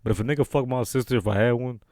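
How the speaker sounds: toned-down and low; subdued restatement. Real, not acted. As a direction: bring it down, low and quiet. Restates it after admitting no sister.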